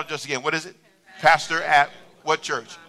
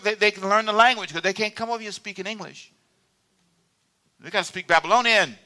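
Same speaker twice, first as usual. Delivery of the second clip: normal talking voice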